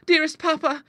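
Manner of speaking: a daughter's pleading cry, pitched high; fond cry. A narrator's reading. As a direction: reach out to soothe him. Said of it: "cried Clara".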